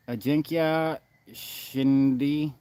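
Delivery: slow, careful try at "Ajinkya Shinde", each syllable spelled out; careful name attempt. Real, not acted. Streamer reading a viewer's name, slowly sounding it out. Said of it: "I am going to butcher this name".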